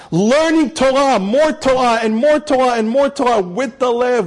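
rising high, slow preacher's plea; preaching build. Acted. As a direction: build each phrase with fervor.

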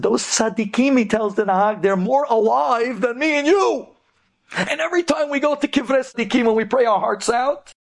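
high, emphatic argument; impassioned rebuttal. Retold or imitated. Kolel student defending the righteous to the taxi driver, impassioned. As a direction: retort with mounting conviction.

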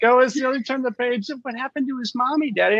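put-on high-pitched little kid voice; child's question. Child asks parent about story.

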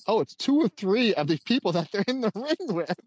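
high, giggling self-mocking realization; laughing at himself. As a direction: catch yourself out and laugh mid-line. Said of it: "I'm like".

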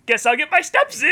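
high, loud forced cheer; over-the-top optimist. Acted. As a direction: take bad news with hammed-up brightness. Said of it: "over-the-top optimist".

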